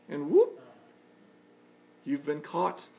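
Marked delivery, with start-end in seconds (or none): 0.3-0.6 quick rising whoop; little upward swoop of a whoop; imitated whoop. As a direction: whoop like being yanked up on a hook. Imitating being caught again.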